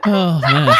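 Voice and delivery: cracking up mid-word; helpless laughter; laughing. Real, not acted. Cracking up at typos.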